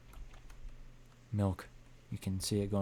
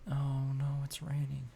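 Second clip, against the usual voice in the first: breathy whispered dismay at the rain; 6 semitones higher, 3 dB quieter than usual. Acted. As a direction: whisper it, almost to yourself. Character notices the rain.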